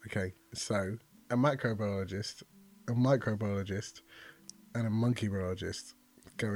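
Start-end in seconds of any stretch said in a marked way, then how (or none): none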